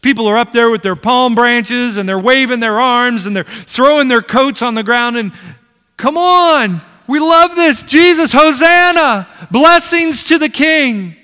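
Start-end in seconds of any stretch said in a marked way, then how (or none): 6.0-11.2 high, loud joyful shouting; loud crowd hails the king; excited cheering crowd. Acted. Crowd cheering Jesus at parade.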